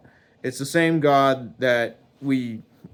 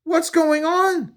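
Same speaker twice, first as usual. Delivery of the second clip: very high, drawn-out question; bewildered; 5 dB louder than usual. Acted. Man baffled by God's anger, bewildered. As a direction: squeal it in confusion.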